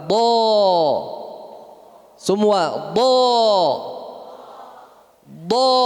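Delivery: "Da." said thick and heavy; heavy dad. Shown as a demonstration. Teacher drilling students on a heavy dad. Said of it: "huruf yang tebal".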